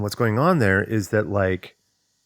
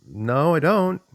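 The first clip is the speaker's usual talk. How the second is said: amused denial; 7 semitones higher, slower than usual. Retold or imitated. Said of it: "I'm like".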